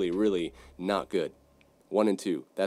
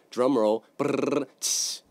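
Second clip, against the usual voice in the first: mouth drum roll; 3 semitones higher, much slower than usual. Shown as a demonstration. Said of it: "that was terrible".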